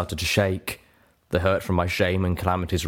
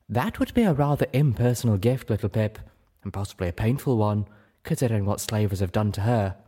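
tactful lady figure; 2 semitones higher than usual. Acted. Velvet cautions Little Pip about a painful gift, tactful reproof.

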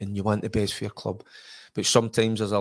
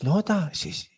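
put-on excited young self; 9 semitones higher than usual. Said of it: "I says".

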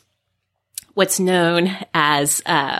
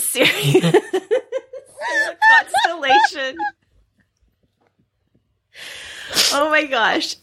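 breaks up laughing, much higher; trying not to laugh. Real, not acted. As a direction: cracks up mid-explanation. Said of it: "I'm trying to be serious".

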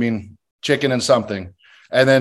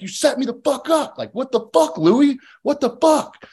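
much higher, mock outraged yell at the producer; re-enacting his rage at being set up by production, much higher; retold angry outburst; 12 semitones higher than usual. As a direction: relive the moment he felt set up, pitch shooting up. Retold blowup at producer Louis.

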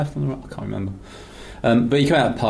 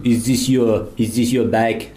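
put-on French accent; slower than usual. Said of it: "with the accents".

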